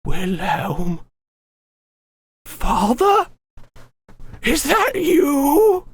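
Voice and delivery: put-on high, crying old woman voice; old lady sobbing out a question; tearful old woman. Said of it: "It'd be a very old woman's voice".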